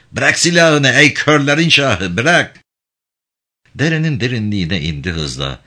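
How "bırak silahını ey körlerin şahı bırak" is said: raised, higher-pitched order in a narrated speech; forceful command. A narrator's reading. Character ordering the king of the blind to disarm, forceful command.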